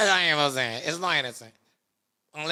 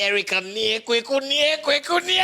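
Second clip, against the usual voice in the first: high, loud imitated call; excited mimicry; 9 semitones higher, 5 dB louder than usual. Imitating what somebody said to Erica, rising outburst. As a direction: build higher and louder on each repeat.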